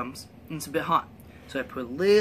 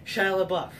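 ordinary delivery

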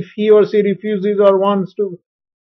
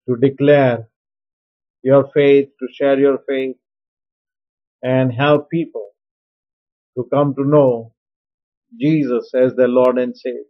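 lower, gentle benediction voice; closing blessing; 6 semitones lower than usual. Acted. Pastor blessing the listeners as they share their faith, low and prayerful.

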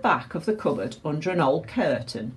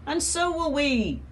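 put-on children's voice; 10 semitones higher than usual.

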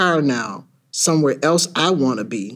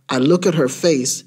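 usual speaking voice

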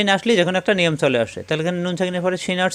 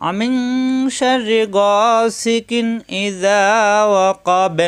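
very slow, higher melodic chant; Quran recitation; 5 semitones higher, much slower than usual. Acted. Teacher reciting the verse to students, slow melodic chant. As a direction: draw each syllable out in tilawat.

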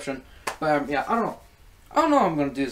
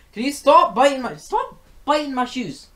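much higher exasperated scold, slowing at the end; 10 semitones higher, 6 dB louder than usual. Real, not acted. Vlogger telling off the cat biting her shoes, high and exasperated. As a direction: tell the cat off, high-pitched and drawn out.

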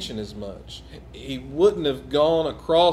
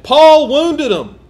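emphatic; 10 semitones higher, 12 dB louder, slower than usual. Real, not acted. Preacher stresses Paul's wounding.

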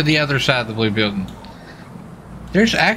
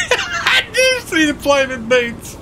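laughing; 14 semitones higher, slower than usual. Real, not acted. Sees partner's flaming boots.

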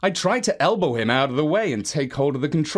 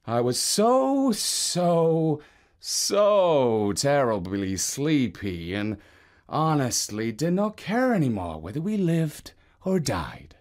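sleepy fade; slower than usual. Acted. Narrator drifting into sleep.

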